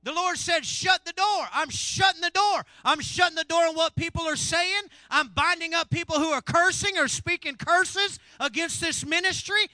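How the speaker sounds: loud, high, driving sermon; full-force preaching. Acted. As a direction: preach it out to fill the room.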